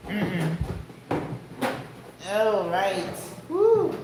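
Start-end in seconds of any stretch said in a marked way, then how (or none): 3.5-3.9 high, happy woo; excited whoop. Real, not acted. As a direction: let out a joyful woo. Cheerful exclamation after greeting.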